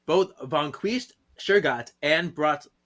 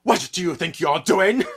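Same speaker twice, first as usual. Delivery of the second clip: menacing voice; 5 semitones higher, 6 dB louder than usual. Retold or imitated. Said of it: "we hear".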